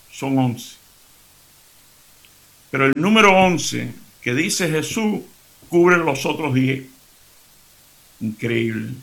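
normal talking voice

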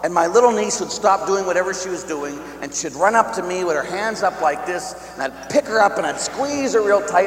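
normal talking voice

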